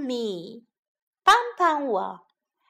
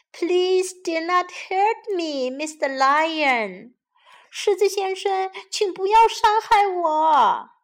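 high, slow pleading mouse voice; squeaky little mouse begging the lion; 7 semitones higher, 4 dB louder than usual. A narrator's reading. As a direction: beg like a tiny frightened mouse. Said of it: "这只小老鼠说".

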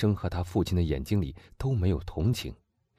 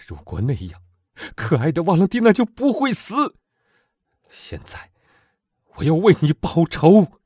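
put-on loud, high, fervent young man; impassioned young man; 8 semitones higher, 7 dB louder than usual. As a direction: demand revenge with burning passion. Morrel vows revenge. (激昂的青年)

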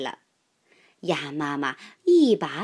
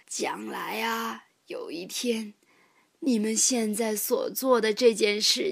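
put-on old rabbit grandpa. A narrator's reading. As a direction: savour each word like a kindly elder.

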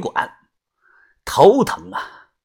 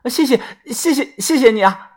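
higher, overcome with gratitude; a grateful woman thanks her lender; moved thanks; 7 semitones higher than usual. A narrator's reading. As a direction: thank him again and again. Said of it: "他表情非常的激动".

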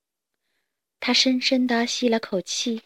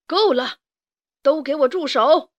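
raised, stern voice; commanding stop; 4 semitones higher, 4 dB louder than usual. A narrator's reading. Father rooster stopping the fight, stern command. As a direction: break it up firmly, a little louder. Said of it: "迪克制止道".

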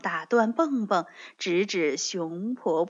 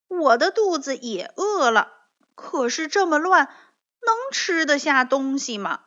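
doubtful bunny; 5 semitones higher, 4 dB louder than usual. Acted. Rabbit doubtful.

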